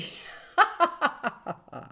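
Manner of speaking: high-pitched acted guffaw; hearty belly laugh. A narrator's reading. Magistrate delighted by his own joke, laughing heartily. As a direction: laugh with full lungs at your own joke. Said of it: "rideva a pieni polmoni".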